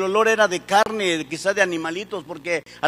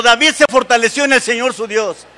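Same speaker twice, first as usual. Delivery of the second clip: loud, insistent preacher's cry; rallying shout; 2 semitones higher, 6 dB louder than usual. Shown as a demonstration. Preacher urges the congregation to say it again, loud rallying shout. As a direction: proclaim it loudly so the crowd repeats it. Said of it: "Grítenlo, por favor".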